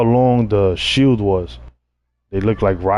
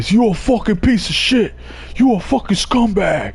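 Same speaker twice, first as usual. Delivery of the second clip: much higher furious mock yell; retold yell; 11 semitones higher than usual. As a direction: bark the insult like an enraged cop. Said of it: "he just yelled at me".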